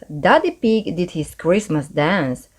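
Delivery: ordinary delivery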